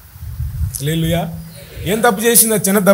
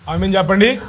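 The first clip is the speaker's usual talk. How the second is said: ordinary talk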